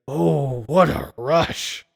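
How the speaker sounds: slow, drawn-out sleazy Freddy voice; hammed-up movie villain; Freddy line. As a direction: savor the rush like the villain.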